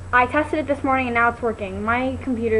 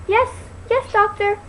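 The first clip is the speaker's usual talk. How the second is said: higher, dutiful Inga; 8 semitones higher than usual. Retold or imitated. Inga answering the doctor, lightly higher. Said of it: "And she goes".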